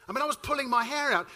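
ordinary talk

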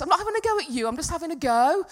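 loud, high stressy protest; stroppy retort. Retold or imitated. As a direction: protest stroppily, pitch and volume up. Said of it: "getting so stressy and so stroppy".

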